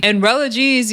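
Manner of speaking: ordinary talk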